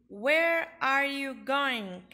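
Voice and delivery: teacher modelling slowly; slow model sentence. Shown as a demonstration. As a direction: spell it out slowly for beginners.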